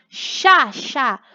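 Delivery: "chá" with a clear sh at the start; sh sound. Shown as a demonstration. Teacher showing the spelling, "chá" stressed with an sh sound. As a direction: repeat "chá" as a separate, stressed syllable. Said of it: "com som de X".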